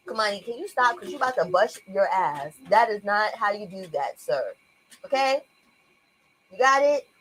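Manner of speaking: quiet, firm mom voice. Real, not acted. Mother quietly warning her climbing son, firm but soft. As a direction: warn him low and steady.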